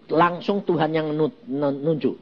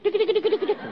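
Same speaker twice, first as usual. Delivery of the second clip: high mimicking sound; much faster than usual. Imitating the swaying walk.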